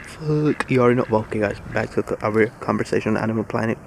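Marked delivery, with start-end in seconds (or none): none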